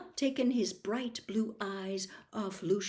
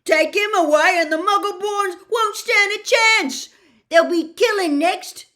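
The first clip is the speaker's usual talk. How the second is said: very loud, much higher desperate shout; yelling; 12 semitones higher, 15 dB louder than usual. Acted. Hagrid yells that Muggleborns will die. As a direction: shout in panic.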